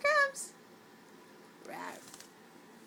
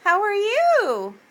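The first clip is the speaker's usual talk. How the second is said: high, loud, drawn-out coo; gushing, stretched-out pet talk; excited pet greeting; 7 semitones higher, 13 dB louder, much slower than usual. Real, not acted. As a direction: sing out a slow greeting to a pet. Cooing greeting to pet bird.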